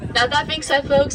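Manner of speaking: ordinary delivery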